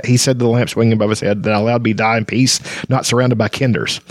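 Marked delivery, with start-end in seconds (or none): none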